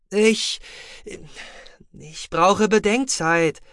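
high, halting plea; panicky hesitation. A narrator's reading. Overwhelmed Otoba asks for time to think, high and hesitant.

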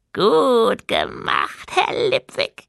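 high, slow goblin giggle; giggling goblin. A narrator's reading. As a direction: giggle the words out. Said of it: "kicherte der Goblin".

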